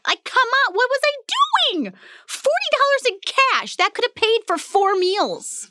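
high, incredulous at own folly; laughing self-mockery. Real, not acted. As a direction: scold yourself while laughing. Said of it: "mocking past self".

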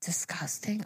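voiceless, hushed disgust; disgusted whisper. Real, not acted. Telling a friend about the used product, disgusted whisper. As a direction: whisper it under your breath, revolted.

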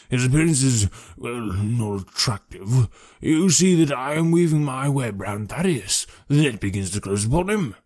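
wheezy policeman weaving his theory; blustering, wheezy police detective. Acted. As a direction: lay out the case with ponderous self-importance.

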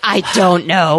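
slow mock-intense reply. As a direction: drag it out with exaggerated intensity. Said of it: "He's very intense".

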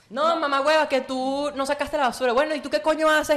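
high-pitched mock indignant retort; acted quarrel. Host acting out a row about the trash, mock indignant.

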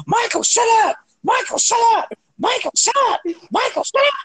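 high mock yell at Michael, over and over; shrill repeated shout of shut up; retold screaming. Brother yelling at nagging Michael, mock high shout. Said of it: "You just kept screaming at him".

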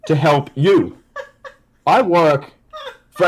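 very slow deadpan phone voice; Randy Orton. Pretend. Telemarketer introducing himself to frantic caller, slow deadpan. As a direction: drag it out with long, deliberate pauses.

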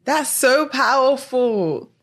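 high, slow, heartfelt awe; deeply moved. Real, not acted. Co-host responding to guest's testimony, deeply moved. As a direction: let it out slowly, overwhelmed.

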